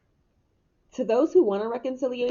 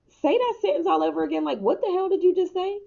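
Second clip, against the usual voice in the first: higher mock scolding mom; imitating mom; 10 semitones higher than usual. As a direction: play the exasperated mom, voice up high. Mom correcting her daughter's speech.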